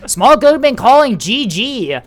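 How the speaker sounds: high, loud excited call. Real, not acted. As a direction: shout it out as the match swings.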